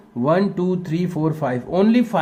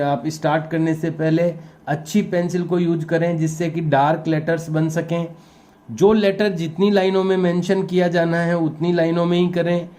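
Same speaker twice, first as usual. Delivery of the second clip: usual speaking voice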